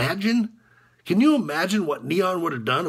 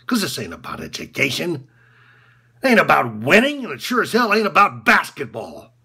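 normal talking voice

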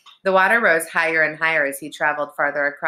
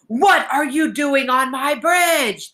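slow, raised monster roar; outraged troll, high and drawn out; angry troll demanding; 9 semitones higher, 4 dB louder, slower than usual. A narrator's reading. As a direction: demand it furiously, dragging the words. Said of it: "the troll even angrier ... the troll demanded".

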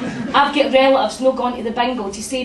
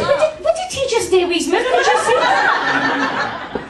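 laughing disbelief; 12 semitones higher than usual. Real, not acted. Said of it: "laughing disbelief".

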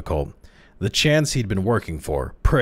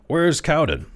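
nervous demand; 9 semitones higher, 3 dB louder than usual. A narrator's reading. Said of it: "he demanded nervously".

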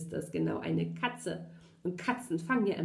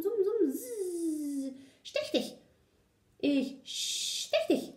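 high, menacing bee with hissing buzz; 9 semitones higher, slower than usual. A narrator's reading. Bee chasing the puppy to sting him, high threatening buzz. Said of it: "summte immerzu".

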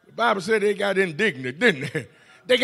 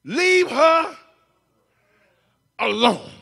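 higher, firm command; Jesus tells the disciples to leave her alone; firm rebuke; 8 semitones higher, slower than usual. Retold or imitated. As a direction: shut them down. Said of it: "He said".

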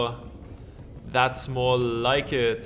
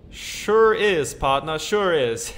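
cowboy drawl; 2 semitones higher, 6 dB louder, much slower than usual. Acted. Gabby agreeing with his partner, hammed-up cowboy accent. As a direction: ham up the old cowboy.

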